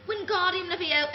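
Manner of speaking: high prim British spell casting, Wingardium Leviosa said haughtily; a prim young witch correcting nobody yet; Emma Watson impression. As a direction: cast the spell like a know-it-all schoolgirl. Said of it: "somebody said I sound like Emma Watson".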